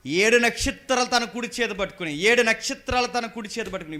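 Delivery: loud, drawn-out declaiming of scripture; emphatic scripture reading. Acted. Preacher reading Revelation to the congregation, loud and emphatic.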